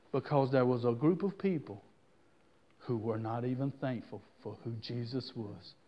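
low, soft, solemn aside; hushed. Real, not acted. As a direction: drop down low and quiet. Preacher drops voice.

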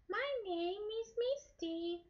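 put-on very high, slow little-girl voice; teacher voicing the girl puppet Misty for the class. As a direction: squeak it out like a shy little girl. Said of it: "Hi. What's your name?".

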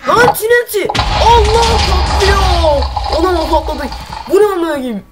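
high, loud mock panic as the TNT goes off; imitating a panicked player. Gamer voicing the trapped player as the trap explodes, high panicked yell. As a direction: yelp in hammed-up shock at the blast. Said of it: "bakın ya şimdi nasıl taklit yap".

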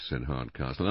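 plain reading voice

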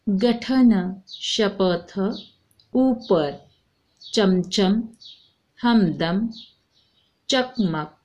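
short words read one by one, clear, with pauses; model reading for the class. Shown as a demonstration. Teacher reading vowel words aloud to children, clear and measured. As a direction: read each word out for the children to echo. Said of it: "पढ़ो".

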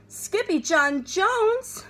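slow, high cry of his name; surprised crowd call; group cry. A narrator's reading. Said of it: "everyone cried".

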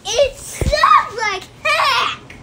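high, loud, drawn-out jab; defiant taunt. Real, not acted. Sister putting down Minecraft to sibling, defiant. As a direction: taunt them loudly, stretching each word. Siblings quarrel over the game.

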